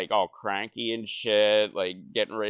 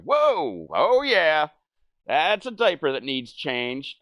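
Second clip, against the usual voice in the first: very high, loud whoa; a kid recoiling from the smell; high, loud whoa that settles; surprised kid; 6 semitones higher, 5 dB louder than usual. Retold or imitated. Kid checking diaper.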